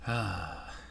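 low, soft exhale; acted sigh. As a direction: let out a quiet, weary breath. Voices character's sigh in game.

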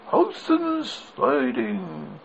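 slow, high drawn-out station call; train conductor calling. A narrator's reading. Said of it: "called out in a loud voice".

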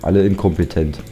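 ordinary talk, nothing marked

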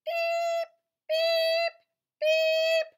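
very high, loud little beeps; squeaky duckling peeps. Acted. Reading to a child, duckling peeping squeakily. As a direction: peep like a tiny duckling. Baby duck on tractor.

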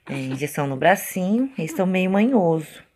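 normal talking voice

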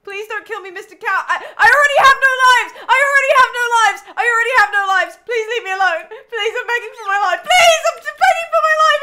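high, loud laughing, crying plea; scared horror game streamer. Real, not acted. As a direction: beg for your life, half laughing. Begging the cat in game.

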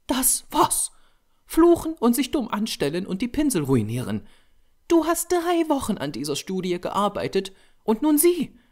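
high-pitched, indignant woman's character voice; scolding model. A narrator's reading. Model berating the painter for tearing the canvas, high indignant scolding.

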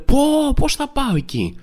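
a touch of granny huffing at directions; imitating an annoyed granny. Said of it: "με νεύρα σχεδόν".

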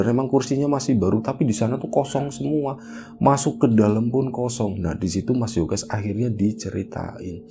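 ordinary delivery, nothing marked

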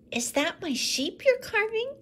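put-on boy asking about the sheep being carved; eager little boy. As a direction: ask hopefully.

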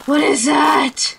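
sharp gasp, then alarmed question; frightened, breath caught first; startled gasp. Real, not acted. Startled by sight in game.

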